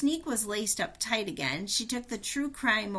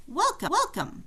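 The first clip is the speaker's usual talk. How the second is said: ceiling speaker voice; 9 semitones higher, 4 dB louder than usual. Acted.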